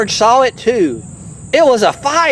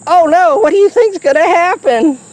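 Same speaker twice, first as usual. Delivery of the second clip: mock alarm; 8 semitones higher than usual. Adult reading, mock alarm aside.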